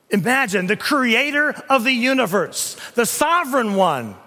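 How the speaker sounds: loud, ringing pulpit delivery; thundering preacher building to a peak; preaching at full force. Acted. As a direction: proclaim it to the whole church. Preacher swelling on God's titles.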